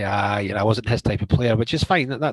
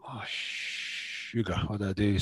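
slow whispered oh sugar, then softer; whispered dismay; 9 dB quieter, slower than usual. Retold or imitated. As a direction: breathe it out, crushed and stuck. Inner dismay after being punished.

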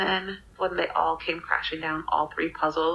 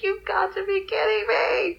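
much higher, whiny wail of exasperation; mock crying at yet another disaster; half-crying disbelief; 13 semitones higher than usual. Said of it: "I was like".

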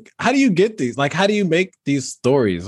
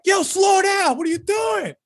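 high yelled alarm from the passenger seat; panicked shout. Retold or imitated. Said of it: "This dude's like".

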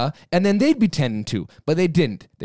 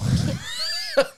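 high laugh. Real, not acted. Reacting to being called passionate.

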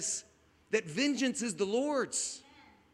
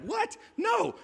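high, incredulous "What? No."; shocked disbelief; 12 semitones higher than usual. Retold or imitated. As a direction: recoil in disbelief at the idea. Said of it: "You're like".

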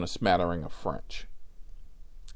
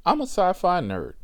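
normal talking voice

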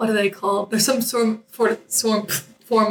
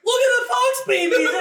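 giddy excited; 11 semitones higher than usual. Retold or imitated. High on nitrous at the dentist, gushing excitedly. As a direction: squeal it like a kid. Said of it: "I was like".

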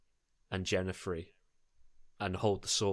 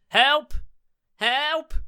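very high, loud cry for rescue; yelling for help; 9 dB louder than usual. Acted. Jasper runs into the street for help, yelling. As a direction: yell it down the street. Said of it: "just yelling".

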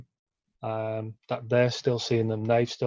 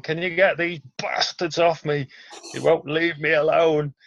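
raised, louder voice of a protesting patient; imitated angry complaint; 4 semitones higher, 4 dB louder than usual. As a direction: act out an indignant plea for help. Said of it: "was trying to punch us as he's seeing his wife, and he's going".

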